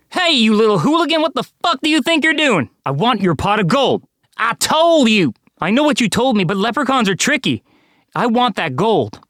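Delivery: high, forceful threatening voice; bully him into handing it over; angry demand. A narrator's reading. Arnold gripping the little man and demanding his gold.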